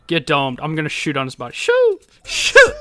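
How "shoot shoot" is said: high whistly "shoo" sounds, repeated; mouthed gunshot noises. Pretend. Gamer mimicking shots in play, mouthed gunshot noises. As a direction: imitate gunfire with your mouth in high bursts.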